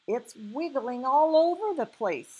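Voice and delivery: high, loud, excited cry. A narrator's reading. Sophie watching the thing in the jar, crying out. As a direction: exclaim it with pitch up. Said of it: "Sophie cried".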